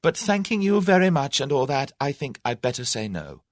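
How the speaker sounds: high-pitched, flustered polite refusal; almost hysterical. A narrator's reading. Tuppence turning down the proposal, near hysterical. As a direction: start high and overwrought, then settle into the refusal. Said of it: "with an almost hysterical laugh".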